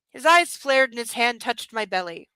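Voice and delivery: ordinary delivery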